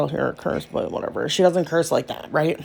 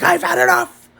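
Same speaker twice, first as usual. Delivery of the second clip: daughter fed up; 11 semitones higher than usual. Retold or imitated. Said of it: "She's like".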